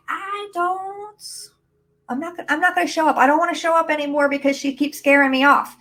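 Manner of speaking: high, whiny protest from a frightened inner voice; scared limiting belief. Acted. Limiting belief balking at the spotlight, scared and high-pitched. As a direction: play a spooked little voice refusing, pitched well up. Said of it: "make that limiting belief scared".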